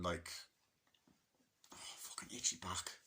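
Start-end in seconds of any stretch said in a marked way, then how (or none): 1.7-2.9 soft whispered gripe to himself; whispered complaint about an itchy back; whispered aside. Real, not acted. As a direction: mutter it under your breath. Muttered aside about an itch.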